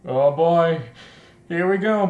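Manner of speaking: ordinary delivery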